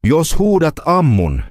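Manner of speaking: usual speaking voice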